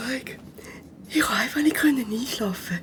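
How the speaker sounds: sleepy whisper to the classmate; tired whisper to his neighbour in class. Acted. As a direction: keep it secret from the teacher. Whispering back during lesson.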